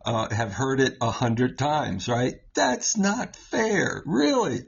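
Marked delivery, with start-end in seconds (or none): 2.5-4.0 put-on whiny kid. Child complaining, heard often. 4.0-4.6 put-on higher, playful teasing reply to a child; lightly hammed-up dad scolding; a hint of a mock-stern parent; mock-indignant parent. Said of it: "I would say to my kids".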